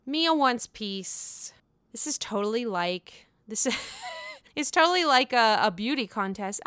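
ordinary delivery

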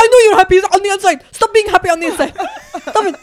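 high, loud, fast laughing scolding. Retold or imitated. Wife scolds husband for being happy inside, loud laughing. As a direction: playfully accuse him of secret joy.

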